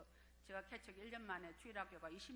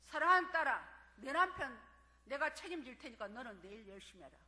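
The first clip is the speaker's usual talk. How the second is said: booming voice of God; 3 semitones higher, 13 dB louder than usual. Retold or imitated. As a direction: announce the divine promise with force. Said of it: "그 음성이 들리는 거예요". (하나님 음성을 크게 외치듯)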